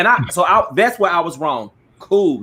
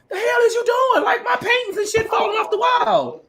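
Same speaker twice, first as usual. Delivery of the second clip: much higher shouted outrage; imitating herself yelling at the neighbor; retold angry shout. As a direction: yell it high like a furious neighbor. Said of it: "I'm like".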